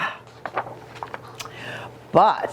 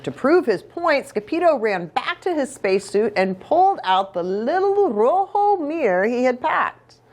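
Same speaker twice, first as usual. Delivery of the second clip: usual speaking voice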